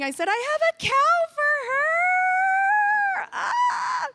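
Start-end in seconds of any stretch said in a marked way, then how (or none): 0.3-3.3 bawling. Retold or imitated. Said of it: "I just started bawling". 3.3-4.1 sudden high cry of overwhelmed joy; high overwhelmed wail; high emotional cry. Retold or imitated. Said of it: "I'm just bawling".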